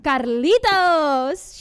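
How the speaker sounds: long drawn-out, high call; shouting. A narrator's reading. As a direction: call him from across the house, stretching the name. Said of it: "she shouts at the man".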